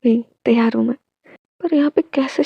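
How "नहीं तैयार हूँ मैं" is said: soft unvoiced whisper; hushed secret whisper. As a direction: breathe it without voice. Hushed line to partner.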